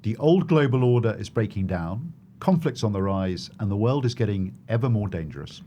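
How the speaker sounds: polished podcast host welcome; podcast intro read. Acted. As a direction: open the show like a presenter.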